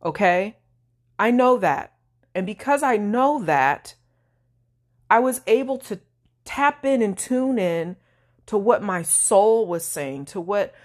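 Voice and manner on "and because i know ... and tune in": ordinary talk, nothing marked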